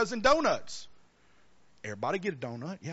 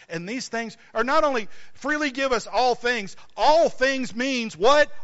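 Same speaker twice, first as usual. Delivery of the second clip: high, loud preacher's cry; preaching at full force; 5 semitones higher, 5 dB louder than usual. Acted. Preacher calling out to congregation, full force. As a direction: rouse the congregation.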